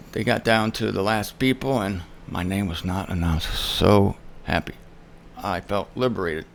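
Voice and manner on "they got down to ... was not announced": normal talking voice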